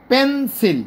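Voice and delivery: "Pencil" as two beats, pen, sil; split into syllables. Shown as a demonstration. Teacher illustrating syllables, "pencil" said in two pushes. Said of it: "পেন এক ধাক্কায়".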